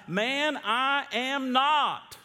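high, loud, stretched-out denial; indignant denial. Acted. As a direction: deny it emphatically, drawing out the last words. Peter's second denial acted.

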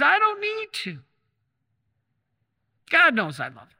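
high, loud and stubborn, a husband digging in his heels; indignant refusal. Retold or imitated. Husband to his wife, refusing Mass, a loud indignant insistence. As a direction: insist you don't need church.

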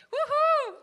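very high, loud whoop; victory cheer. Acted. Pastor cheering his win over housework with a very high, loud whoop. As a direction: whoop like you just won the race.

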